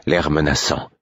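plain reading voice

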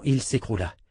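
usual speaking voice

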